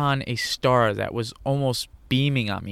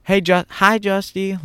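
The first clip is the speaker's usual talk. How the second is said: high, bright grandma greeting; higher imitation of a loving grandmother; grandma's voice; 8 semitones higher, 5 dB louder than usual. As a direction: call him Justy sweetly in a higher voice. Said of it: "In her voice".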